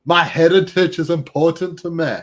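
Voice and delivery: a loud, laughing put-on Scottish accent; a proud fake Scot, hammed up with laughter; mock Scottish accent. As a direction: ham up a fake Scottish brogue while you laugh. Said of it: "my Scottish accent".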